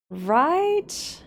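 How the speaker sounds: drawn-out high rising question; high rising right. Real, not acted. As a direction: pitch it way up, rising.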